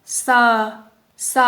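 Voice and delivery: "s" as a plain hissed s plus vowel; careful teacher's demo of "s"; textbook pronunciation. Shown as a demonstration. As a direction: say "s" by the book, as a syllable with an open vowel. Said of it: "we pronounce this sound as".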